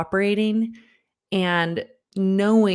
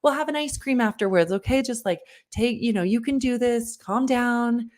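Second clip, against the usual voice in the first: much higher, quick reassuring sing-song; soothing coaxing; 5 semitones higher than usual. Retold or imitated. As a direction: bribe and calm the child in a rush. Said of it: "So I flip back over to".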